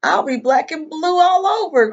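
high, loud acted outcry; storybook cry. A narrator's reading. Mouse at the bottom of the bank, crying out. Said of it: "cried Mouse".

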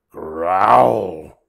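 loud drawn-out growl; big playful monster growl; imitated monster growl. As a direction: growl the word out like the scary noise. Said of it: "dreadful and terrifying sound".

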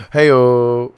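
playful loud welcome; cheery greeting. Real, not acted. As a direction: burst back in with glee.

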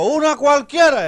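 high, loud, stretched-out call; shouted song request. Acted. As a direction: shout your request to the stage. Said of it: "Dice otro". (grito de público, agudo y alargado)

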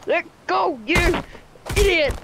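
furious at the character who won't let go; angry shout. Real, not acted. Gamer yelling at the NPC, angry shout.

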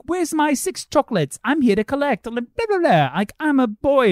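put-on high bratty boy voice; mock a cheeky kid demanding; squeaky boy impression. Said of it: "when Bruno goes in and he's like".

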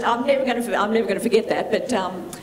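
ordinary talk

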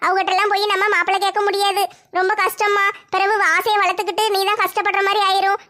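normal talking voice

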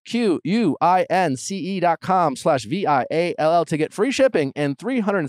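polished promo announcer delivery; podcast ad read. Acted. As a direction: sell it clearly, every web address letter distinct. Reads sponsor ads.

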